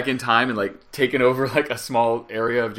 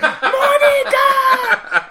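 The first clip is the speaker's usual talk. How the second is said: high, drawn-out mock shout; kids shouting Monica; 5 dB louder, much slower than usual. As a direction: stretch the name into a long high yell. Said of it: "Do the, the Clinton thing".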